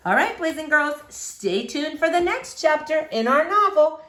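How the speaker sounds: cheerful teacher sign-off; classroom sign-off. Real, not acted. Teacher closing the chapter for the class, cheerful.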